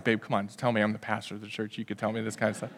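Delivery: low, hushed wheedling; mock coaxing. Pastor husband wheedling a secret from his wife, hushed coaxing.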